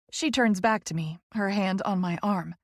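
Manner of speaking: ordinary delivery, nothing marked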